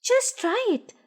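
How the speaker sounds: high, eager urging; can't wait for him to try; Noddy eagerly urging Big Ears. A narrator's reading. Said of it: "said Noddy eagerly".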